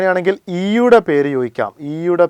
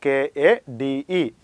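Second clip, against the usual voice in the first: slow dictation; much slower than usual. Shown as a demonstration. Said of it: "ഏതാണ്?".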